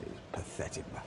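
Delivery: hushed, disdainful aside; mock the onlookers' silent contempt; scornful whisper. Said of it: "businessmen just looking at me like".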